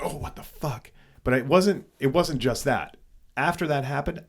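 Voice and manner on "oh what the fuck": high, soft retold shock; startled disbelief, half-voiced. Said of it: "it was more of a shock".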